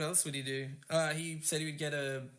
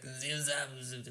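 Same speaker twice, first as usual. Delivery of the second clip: [name] mumbling nonsense; slurred wordless mumble, fading quieter; 3 semitones lower, 3 dB quieter than usual. Pretend. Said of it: "can't even speak".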